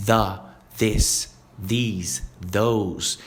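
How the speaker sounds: The, this, these, those each said with the tongue between the teeth; slow careful th on The, this, these, those; th demo word by word. Shown as a demonstration. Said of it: "colocando a língua entre os dentes".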